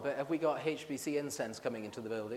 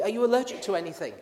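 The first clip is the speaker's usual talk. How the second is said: higher and louder, hurried like a nurse calling out; mock nurse shout; 5 semitones higher, 5 dB louder, faster than usual. [name] mimics the nurse shouting questions, loud and quick. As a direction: rattle it off over the noise of the ward.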